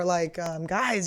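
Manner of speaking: ordinary talk, nothing marked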